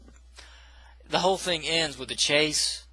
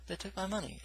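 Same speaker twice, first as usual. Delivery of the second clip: twitchy junkie; 8 semitones higher, 11 dB quieter than usual. Retold or imitated. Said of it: "She's talking like that".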